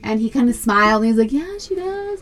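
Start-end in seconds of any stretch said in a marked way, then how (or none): 1.3-2.2 put-on high soft pleased kid voice; a shy smiling yes; pleased boy. Son admits the girl likes him, high and soft. Son's pleased reply retold.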